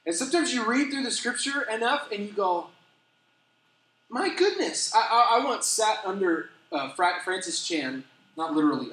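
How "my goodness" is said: high, stunned "my goodness"; awed exclamation. Retold or imitated. Reading the chapter again, stunned and awed. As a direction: gasp it out in wonder. Sudden awe reading scripture.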